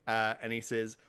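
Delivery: normal talking voice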